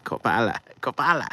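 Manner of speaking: "Coppola" said loud twice as KO-pa-la; repeating the name. Shown as a demonstration. Drilling the director's name aloud, loud "Coppola". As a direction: hammer "Coppola" home, loud and rising. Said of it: "Is it Capello or Coppola?".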